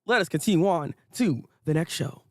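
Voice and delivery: ordinary talk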